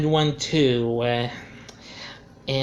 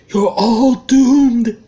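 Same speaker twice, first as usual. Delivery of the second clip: louder ominous doom warning, slow; Crazy Ralph impression; 8 semitones higher, 9 dB louder, much slower than usual. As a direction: draw out the doom, even bigger. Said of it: "I'd give it that because I'm actually pretty good at doing voices".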